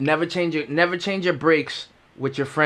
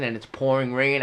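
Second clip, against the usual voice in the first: ordinary talk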